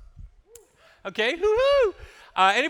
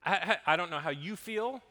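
soft sermon; 6 semitones lower, 12 dB quieter than usual. Acted. Quiet reflective sermon close.

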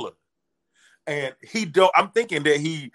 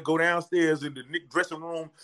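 normal talking voice

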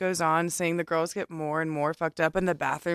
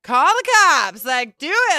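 defiant yell; 7 semitones higher, 7 dB louder, slower than usual. Retold or imitated. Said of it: "And I was like".

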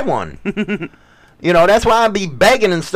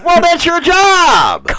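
mock bossy; 8 semitones higher, slower than usual. Teasing claim in marital banter.

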